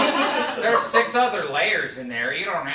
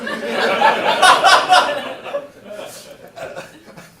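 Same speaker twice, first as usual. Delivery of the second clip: loud laugh; 11 semitones higher, 12 dB louder than usual. Real, not acted. Laughing at own absurd list.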